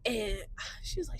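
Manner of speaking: ordinary talk, nothing marked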